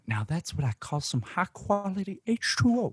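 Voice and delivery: Water Boy impression. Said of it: "You guys know a classic".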